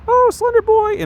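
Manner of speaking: put-on very high falsetto girly voice; mock girlfriend voice. Streamer voicing his teenage crush's fantasy lines in high falsetto. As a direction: squeal it in a high dreamy girl voice. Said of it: "I'd have her say something like".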